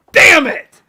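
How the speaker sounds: loud, high comic yell; hammed-up cry of defeat; mock outburst. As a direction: belt it like a cartoon loser. Retold drive-thru disappointment.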